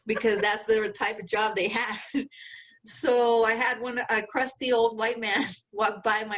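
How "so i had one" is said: ordinary talk, nothing marked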